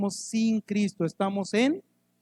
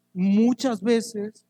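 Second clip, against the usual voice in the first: slowed emphasis; much slower than usual. Real, not acted. Stressing how often it appears.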